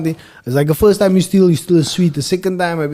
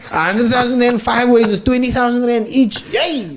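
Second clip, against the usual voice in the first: mock excited; 7 semitones higher than usual. Imagined payout celebration.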